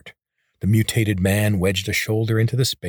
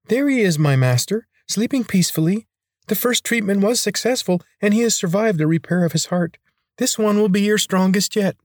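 much higher, fawning servant; squeaky eager servant; servile high voice; 12 semitones higher, 3 dB louder than usual. A narrator's reading. Attendant reports to his master.

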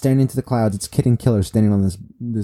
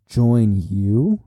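incredulous. Acted. Luffy scoffs at Kaido's offer.

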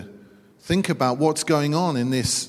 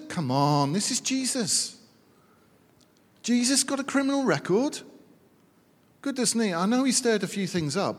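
bewildered righteous; 5 semitones higher than usual. Retold or imitated. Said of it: "can imagine them pointing the finger".

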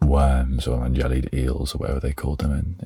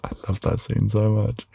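giggly; 7 semitones higher, 4 dB quieter than usual. Real, not acted. Still in high giggly voice.

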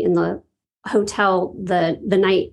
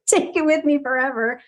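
laughing; 8 semitones higher than usual. Real, not acted. Laughs while moved.

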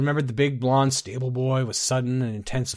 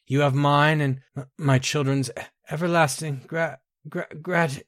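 higher, halting, breaking into stammers; 4 semitones higher than usual. Acted. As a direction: choke up and falter until the words fail. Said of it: "She broke down".

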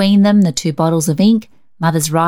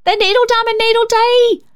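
excited scream. A narrator's reading. Rhonda squealing over the clowns to Cecil, very high thrilled scream. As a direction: jump up and down with glee. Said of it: "screamed Rhonda".

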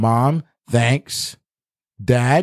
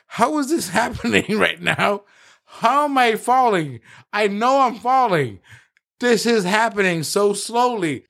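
higher, laughing through a stunned question; bewildered disbelief; 8 semitones higher than usual. Retold or imitated. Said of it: "I looked at him and I was like".

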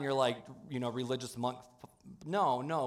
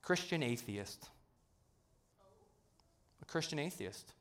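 hushed reveal; 11 dB quieter than usual. Real, not acted. Preacher to congregation unveiling the term, hushed. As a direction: let it land quietly. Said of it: "here is that oxymoron".

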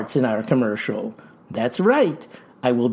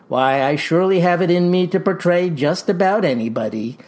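ordinary talk

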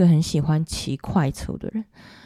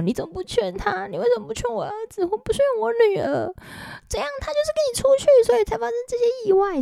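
much higher, accusing parent's voice; blaming parent; faster than usual. Retold or imitated. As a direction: play the grieving parent hurling blame. Imitating parents blaming the companion. (模仿家长高声指责)

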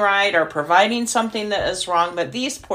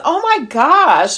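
higher, louder, slow delighted gasp of surprise; surprised; 9 semitones higher, 6 dB louder, slower than usual. Retold or imitated. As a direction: let it burst out amazed and drawn out. Caller surprised by callback.

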